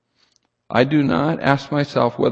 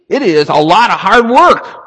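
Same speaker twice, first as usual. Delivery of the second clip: loud, higher-pitched insistence; raised voice punching every word; emphatic preaching; 5 semitones higher, 6 dB louder than usual. Real, not acted. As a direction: drive it home at a near shout. Preacher driving home his point.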